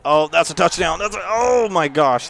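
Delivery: loud, high and climbing; thrilled outburst. Real, not acted. Gamer watches a near touchdown, bursting with excitement. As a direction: yell as the score seems certain.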